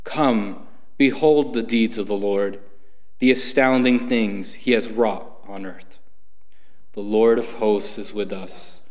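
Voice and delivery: normal talking voice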